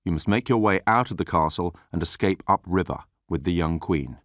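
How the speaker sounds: ordinary delivery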